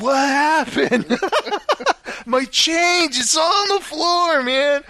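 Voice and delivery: high, slow bewildered; waking up baffled; confused surprise. Retold or imitated. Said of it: "Kinda like".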